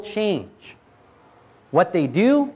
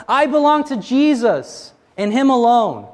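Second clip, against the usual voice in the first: raised, higher declaration of belonging; proclaiming shout; 7 semitones higher, 4 dB louder than usual. Retold or imitated. Believer shouts allegiance to Jesus, raised and higher. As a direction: call it out boldly, higher and louder. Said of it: "we come to the point where we shout".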